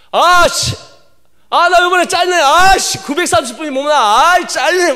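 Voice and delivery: loud, high frustrated groan at a bad score; frustrated outburst. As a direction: blurt it out like a kid cursing a bad result. Friends complaining about their scores.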